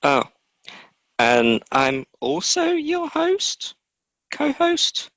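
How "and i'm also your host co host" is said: high, slow, silly co-host sign-on; absurdly high mock announcer; ridiculous intro. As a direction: ham up the introduction in a high drawn-out voice. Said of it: "you do it very ridiculously".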